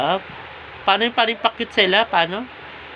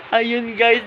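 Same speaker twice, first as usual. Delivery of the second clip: ordinary talk, nothing marked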